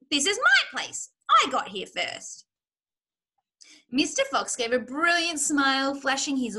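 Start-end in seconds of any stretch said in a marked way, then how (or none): 0.1-2.5 high, loud territorial outburst; shrill claim on your own turf; shrieking. A narrator's reading. Rat defending his place from the fox, shrieking. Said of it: "shrieked Rat".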